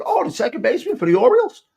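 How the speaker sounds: as if it just clicked who he is; surprised recognition. Retold or imitated. Told who the guy is, host in sudden surprised recognition.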